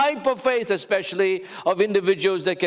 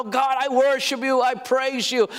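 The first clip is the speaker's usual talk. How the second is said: loud praise; 4 semitones higher than usual. Shown as a demonstration. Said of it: "be as loud".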